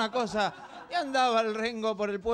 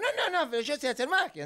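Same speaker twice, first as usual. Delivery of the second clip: put-on insistent kid; 8 semitones higher than usual. Pepito insists.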